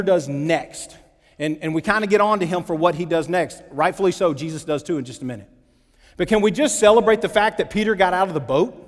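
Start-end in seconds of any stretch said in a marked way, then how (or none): none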